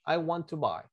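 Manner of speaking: ordinary talk, nothing marked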